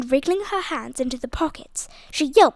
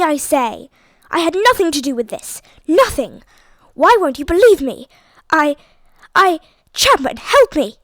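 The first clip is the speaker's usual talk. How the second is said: desperate shouting; 3 semitones higher, 8 dB louder than usual. A narrator's reading. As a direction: yell for help, outraged. Lord Hastings struggling with police.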